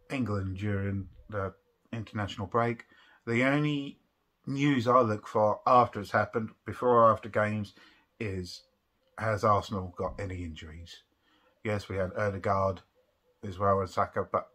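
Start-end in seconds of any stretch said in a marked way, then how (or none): none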